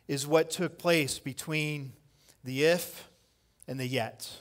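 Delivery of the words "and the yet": ordinary delivery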